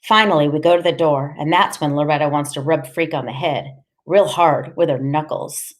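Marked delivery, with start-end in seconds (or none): none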